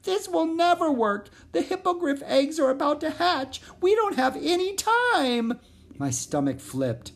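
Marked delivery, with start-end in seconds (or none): 0.0-5.6 very high, wailing frog voice; a tiny frog despairing through tears; crying frog. Acted. Crying frog telling Zoe it will never work. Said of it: "started to cry again".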